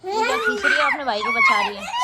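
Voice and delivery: very high, squealing laugh through the words. Real, not acted. Mother laughing at her kids tugging each other. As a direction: laugh helplessly while describing it.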